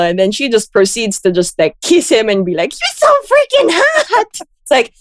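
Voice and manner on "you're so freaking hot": much higher, gushing swoon; swooning Harley. Retold or imitated. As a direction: gush like a smitten girl. Voicing Harley swooning over villain.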